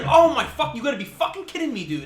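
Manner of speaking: loud, high, fast disbelief; loud fast incredulous yell at the dog; exasperated outburst. Retold or imitated. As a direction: blurt out shocked frustration. Retells his shocked reaction.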